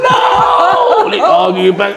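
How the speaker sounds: put-on panicked kids begging daddy no; frantic pleading. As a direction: cry out to stop them. Sons pleading during fight.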